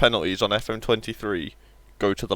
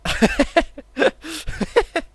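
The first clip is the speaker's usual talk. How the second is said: loud laugh; 5 dB louder than usual. Real, not acted. Laughing at own joke.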